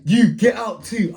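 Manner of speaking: high-pitched order to leave; mock irate father; acted angry dad. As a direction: round on the next culprit. Dad orders second boy out.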